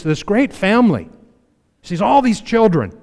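normal talking voice

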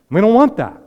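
loud, high insistence; emphatic shout. Real, not acted. Preacher shouting to the congregation, emphatic. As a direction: cry it out to the congregation.

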